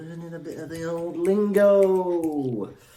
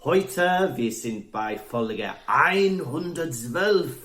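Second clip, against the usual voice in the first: lightly put-on German host voice; mock German presenter. Welsh co-host pretending to be German, light German accent. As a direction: play at being a German presenter. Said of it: "Yes, that's German, that boy's speaking".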